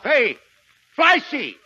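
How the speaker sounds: calling out. Acted. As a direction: hail him from a distance. Calling the boy.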